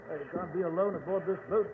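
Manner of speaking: ordinary talk, nothing marked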